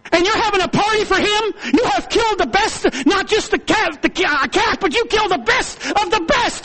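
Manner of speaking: high, loud bitter complaint; the jealous son lashing out at his father; angry older son. Acted. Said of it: "He said".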